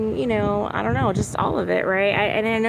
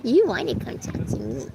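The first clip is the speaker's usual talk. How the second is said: lightly raised sing-song coaxing "You wanna come talk to me"; 3 semitones higher than usual. Real, not acted. Coaxing her pet bird to come over, light baby talk.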